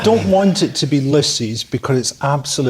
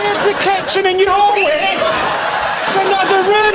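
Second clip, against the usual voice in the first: shouting; 14 semitones higher than usual. Real, not acted. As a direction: shout it over the laughter. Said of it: "It's open plan".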